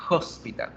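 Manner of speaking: "hospital" begun with an audible jota; H as Spanish J. Shown as a demonstration. Teacher repeats before playing the audio, "hospital" with J sound. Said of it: "es el sonido de la J".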